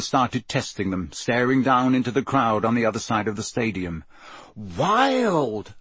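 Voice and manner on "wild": high, drawn-out marvelling; amazed. A narrator's reading. Ron tests his omnioculars, amazed. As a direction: stretch the word out in wonder.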